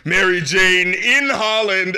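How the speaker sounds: slow, stretched, mock-sung "in Holland". Host mimics [name]'s other version of the lyric, slow. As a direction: drag the words out, mocking. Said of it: "another part where she was like".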